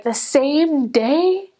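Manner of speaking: high, drawn-out disbelief; slowly, stunned he gave up so fast; incredulous. Retold or imitated. Missionary hearing he quits the same day, stunned.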